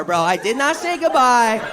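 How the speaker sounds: ordinary talk, nothing marked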